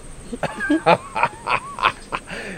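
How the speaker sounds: high giggling laugh; shy giggle. Acted. Shy woman laughs at suitor.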